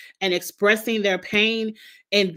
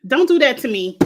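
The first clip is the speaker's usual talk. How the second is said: raised, louder exclamation of disgust; loud protest; 4 semitones higher, 6 dB louder than usual. Real, not acted. To coworker sharing gory photos, a loud protest. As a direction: push it away, loud and firm.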